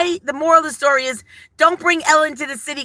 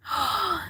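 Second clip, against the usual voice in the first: soft gasp of delight; excited gasp; 5 semitones higher, 11 dB quieter than usual. Retold or imitated. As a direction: gasp as if spotting a treat. Retelling spotting a treat.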